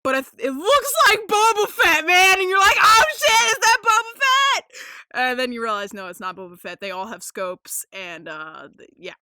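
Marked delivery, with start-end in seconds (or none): 0.4-4.7 high, loud thrilled shout; excited fan outburst. Acted. Host reliving the shock of the scene, excited shouting. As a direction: yell it like a stunned fan spotting a hero. Acting out fans' excited reaction.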